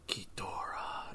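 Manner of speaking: slow, unvoiced whisper of the name; drawn-out, eerie whispered name; haunting imitated whisper. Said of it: "all I hear right before I'm going to bed".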